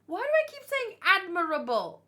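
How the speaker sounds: exasperated at herself. Real, not acted. Reacting to the chapter, annoyed at her own slip, exasperated. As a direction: groan it out, annoyed at the mistake.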